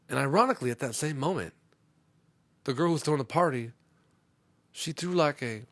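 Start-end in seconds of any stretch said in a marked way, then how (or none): none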